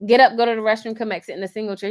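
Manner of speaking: ordinary delivery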